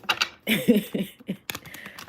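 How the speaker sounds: breathy laugh cut short by a tight stomach; strained laugh. Real, not acted. Reader laughing at her own joke, strained laugh. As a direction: laugh that hurts to get out. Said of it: "I can't even laugh".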